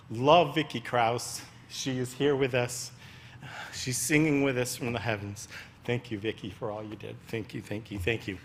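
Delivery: quiet, heartfelt tribute fading to hushed thanks; getting emotional. Real, not acted. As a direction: thank a lost friend, real emotion pulling the voice down. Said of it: "I'm sorry for getting emotional".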